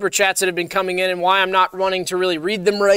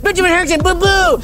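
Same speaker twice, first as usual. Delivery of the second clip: hammed-up frightened yelps, higher throughout; mock terror; 6 semitones higher than usual. Host pretending to fear fans while reading superchats, hammed-up shrieking terror. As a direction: play scared of every name you read.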